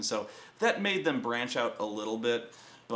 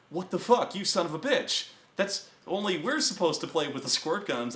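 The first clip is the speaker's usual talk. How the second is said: mock-horrified protest, pitched up; horrified outrage; 6 semitones higher than usual. Players protest to the game master about squirt guns, horrified. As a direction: imitate players aghast and indignant. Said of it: "they're horrified by this".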